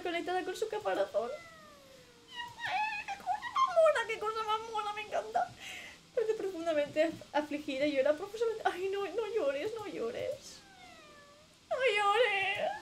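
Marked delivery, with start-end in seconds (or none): 2.7-5.5 cutesy squeal, voice cracking up high; cooing. Real, not acted. Cooing over cute creature again. 8.6-12.7 high, slow pleading coo; comforting coo. Real, not acted. Comforting crying creature.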